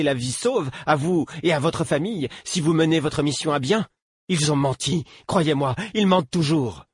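put-on a frightened boy gone shrill; shrill accusing boy. A narrator's reading. Captive boy hurls accusations at his captor, shrill. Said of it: "sa voix devint stridente".

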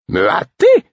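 indignant high retort; sharp retort. A narrator's reading. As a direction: fire back at him in a high voice. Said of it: "rétorqua-t-elle".

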